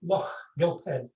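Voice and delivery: "Loch Gilphead" said assuredly, with a falling end; correct pronunciation. Shown as a demonstration. Piper repeating the name for listeners back home, correct pronunciation. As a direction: model "Loch Gilphead" for listeners, settled and sure. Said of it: "can you maybe repeat that in a correct pronunciation".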